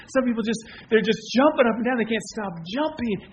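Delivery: ordinary talk, nothing marked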